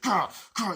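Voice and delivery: high, loud imitated growl; mock growl. Nicolas Cage's character growling at the man. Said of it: "He's like".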